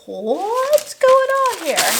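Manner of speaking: high, slow, baffled; high drawn-out mock puzzlement; puzzled. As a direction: stretch it out in high disbelief. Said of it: "We were like".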